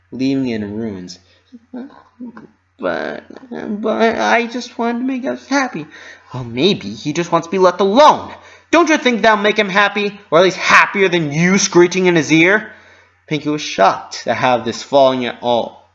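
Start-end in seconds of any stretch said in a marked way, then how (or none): 2.8-5.9 hurt pony voice. A narrator's reading. Pinkie hurt. 6.3-12.7 scolding. A narrator's reading. Roseluck scolds.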